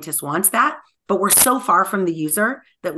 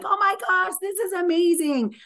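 high-pitched mock excitement at normal volume; gushing crowd; 10 semitones higher than usual. Imitating fans around the builders, gushing in a high voice. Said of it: "everyone around you is like".

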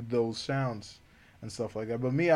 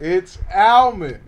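loud and slow, higher; emphatic declaration; 7 semitones higher, 13 dB louder, slower than usual. Real, not acted. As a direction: proclaim it firmly, drawn out. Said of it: "You can argue with us in the comments".

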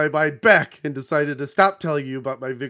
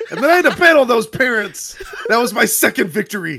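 louder, higher, proud yelling; triumphant shout; 8 semitones higher, 6 dB louder than usual. Acted. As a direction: bellow the victory. Shouted boast in script.

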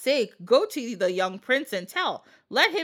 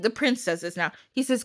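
ordinary talk

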